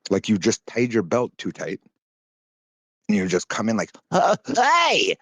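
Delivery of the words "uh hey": high, loud goofy greeting; wacky hey voice. Retold or imitated. Mocking a streamer's wacky entrance voice. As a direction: bust in with a dopey hey. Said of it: "Do that voice again".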